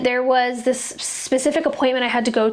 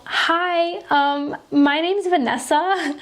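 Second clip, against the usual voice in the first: sweet nervous greeting; 6 semitones higher, much slower than usual. Retold or imitated. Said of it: "I go".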